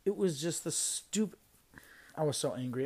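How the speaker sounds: normal talking voice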